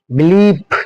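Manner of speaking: बिलीफ drawn out on the ee; long vowel. Shown as a demonstration. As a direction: model बिलीफ slowly with a held vowel. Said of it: "इस तरह को उच्चारण करना है".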